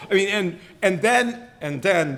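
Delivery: ordinary talk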